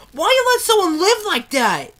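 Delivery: high-pitched, anguished question; real appalled outcry; distressed protest. Real, not acted. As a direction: cry it out high in disbelief. Upset protest at character's fate.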